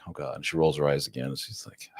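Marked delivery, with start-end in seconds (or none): 0.0-0.3 fed-up groan. Retold or imitated.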